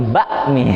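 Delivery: "bakmi" with the k as a pressed hamzah stop; exaggerated glottal stop. Shown as a demonstration. Teacher joking about practice on everyday words, pressed stop in bakmi. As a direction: cut sharply after the first syllable of "bakmi". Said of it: "Besok kalau ngomong bakmi".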